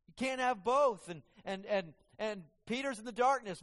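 preacher building to a climax; fiery preaching. Acted. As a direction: drive each point home to the congregation. Preaching at full force.

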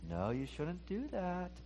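hushed, slow contradicting voice; shoulder devil voice. Acted. Little voice on the other shoulder arguing back, hushed and slow. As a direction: object very softly, drawing the words out. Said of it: "the other one's saying".

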